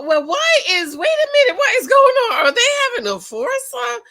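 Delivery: scandalized high squeal; shocked outburst. Real, not acted. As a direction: shriek like you can't believe your eyes. Shocked by the TV clip.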